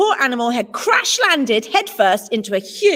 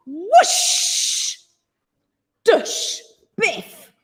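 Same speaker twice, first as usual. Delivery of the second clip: sound effects; 6 semitones higher, much slower than usual. A narrator's reading. Narrator voices sound effects.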